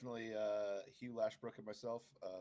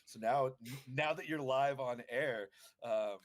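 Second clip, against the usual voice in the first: playful laughing tease; higher, louder teasing laugh; 5 semitones higher, 6 dB louder than usual. Real, not acted. Teasing on live stream.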